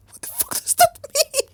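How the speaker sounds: high, laughing outburst; laughing disbelief. Real, not acted. Reader puzzled by "hunky-dory", laughing outburst. Reacting to odd word, laughing.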